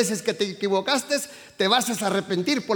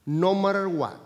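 ordinary talk, nothing marked

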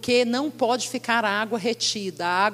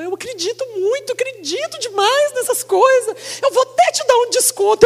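high, louder, gushing mock saleswoman; 13 semitones higher, 7 dB louder than usual. Preacher voicing the tearful saleswoman offering a discount. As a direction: play the moved clerk, all heart.

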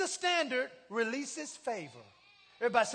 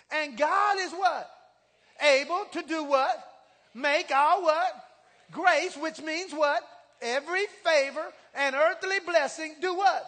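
louder, slow call-and-response questions; preaching at full force; 3 semitones higher, 10 dB louder, slower than usual. Acted. Preacher quizzing congregation on the verse, loud and slow. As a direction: drive each question at the crowd.

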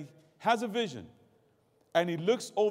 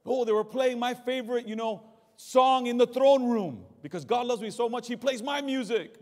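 higher, bubbly mock excitement; mocking giddy churchgoer; 5 semitones higher, 5 dB louder than usual. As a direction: parody the shallow churchgoer.

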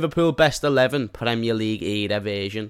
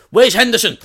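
mock outraged fan; 9 semitones higher, 8 dB louder than usual. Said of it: "younger fans are gonna be like".

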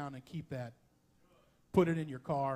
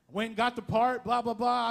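ordinary talk

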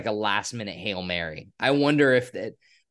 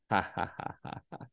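sarcastic fake laugh; 5 semitones lower, 10 dB quieter than usual. Mocking the dog joke.

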